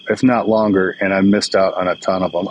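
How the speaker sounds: ordinary talk, nothing marked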